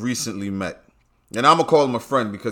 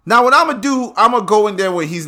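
louder, higher plotting; loud scheming; 10 semitones higher, 8 dB louder than usual. Real, not acted. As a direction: announce the plan loudly.

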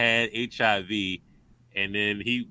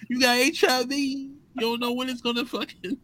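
mock correction; 12 semitones higher than usual. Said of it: "I was like".

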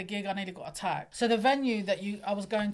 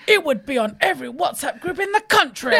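louder, higher, laughing panic; mock panicked warning; 5 semitones higher, 11 dB louder than usual. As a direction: blurt it as if the scandal's already out. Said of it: "He goes".

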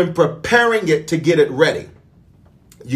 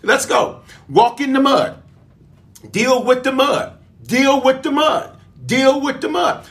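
preaching at full force; 8 semitones higher than usual. Acted. Preacher urges the congregation to walk in the mud, rising higher. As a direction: push the church higher each time.

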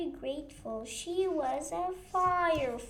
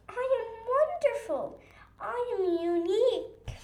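proud; 7 semitones higher, 7 dB louder, faster than usual. A narrator's reading. Kiri proudly declares.

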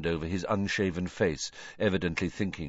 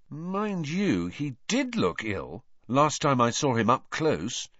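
measured, slightly slow recollection; slow, pondering; 7 semitones higher than usual. A narrator's reading. Harry recalling how ill Crouch looked, slowly. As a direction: think it through aloud as you speak. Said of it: "said Harry slowly".

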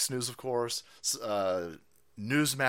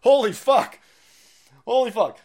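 much higher and louder retold outburst; shocked exclamation; 8 semitones higher, 10 dB louder than usual. Retelling his reaction while watching the feud, loud shocked blurt. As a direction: blurt it like it just knocked you flat. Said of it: "I'm sitting there going".

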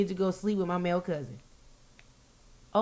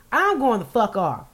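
ordinary talk, nothing marked

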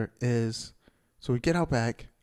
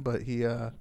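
normal talking voice

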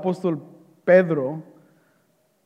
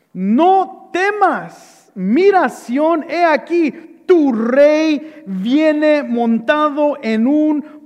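slow, raised, emphatic scripture quote; prophetic proclamation; 5 semitones higher, 3 dB louder, much slower than usual. Acted. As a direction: proclaim each phrase, stretched and pitched up. (profecía proclamada, lenta y enfática)

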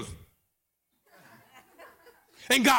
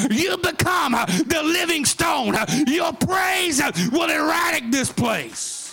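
shouted, rhythmic sermon climax at a high pitch; sing-song hollering preacher in full flight; full-force preaching; 5 semitones higher than usual. Acted. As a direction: preach it hard, whooping toward the crowd.

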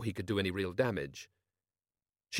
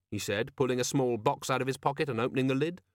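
ordinary delivery

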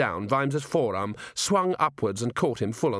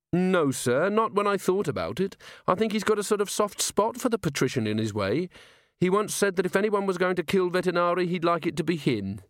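higher-pitched, earnest subordinate voice; pitched up, respectful and sincere; earnest officer; 8 semitones higher than usual. A narrator's reading. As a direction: voice a dutiful officer reporting to a superior.

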